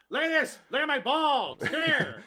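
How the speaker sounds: loud, high-pitched mock yelling; hammed-up creep calling someone over; Richard Dreyfuss. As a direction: shout it high, as a crude caricature. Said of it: "I'm Richard Dreyfuss".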